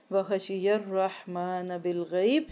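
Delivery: slow, drawn-out reverent chanting; Quranic recitation. Acted. Teacher recites the next verse to her students, slow chant. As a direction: intone each Arabic word slowly.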